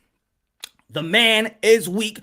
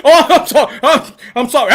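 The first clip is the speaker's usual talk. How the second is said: ordinary delivery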